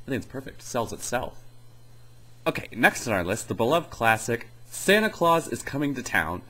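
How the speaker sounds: normal talking voice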